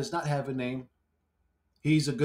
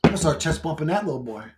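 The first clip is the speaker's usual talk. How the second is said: usual speaking voice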